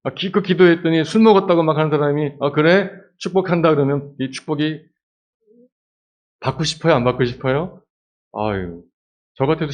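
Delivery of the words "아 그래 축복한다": high, loud careless toss-off; offhand blessing. Pretend. Priest tosses Hannah a careless blessing. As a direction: bless her without meaning it.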